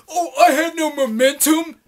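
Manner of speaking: high, loud, slowed whiny mock voice with a laugh; mocking a commenter. Mocking a YouTube critic's excuse in a loud, high whiny voice. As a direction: sneer his excuse back at him. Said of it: "But he".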